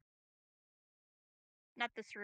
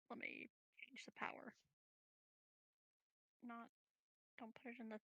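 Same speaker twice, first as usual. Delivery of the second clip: whisper; 13 dB quieter than usual. Real, not acted. Player muttering to self while acting.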